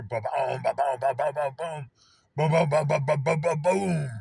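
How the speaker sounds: loud booming volley building to a big boom; imitated firework explosions. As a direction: pound out rapid booms, rising in pitch and force. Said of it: "just trying to describe".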